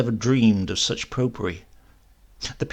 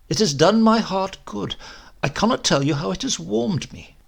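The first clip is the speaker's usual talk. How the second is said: a touch higher, earnest thanks; lightly voiced gushing parson; 7 semitones higher than usual. A narrator's reading. Young parson greeting the converts, lightly raised and earnest. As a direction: gush like a happy young curate.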